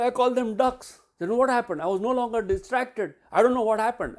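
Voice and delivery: high, loud, elated retelling; so excited. Retold or imitated. Player reporting to coach after winning match, excited. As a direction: imitate a thrilled player blurting her news. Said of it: "she was so excited".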